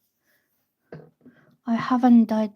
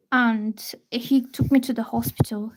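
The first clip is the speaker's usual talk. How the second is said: ordinary talk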